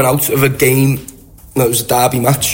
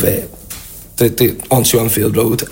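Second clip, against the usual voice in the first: usual speaking voice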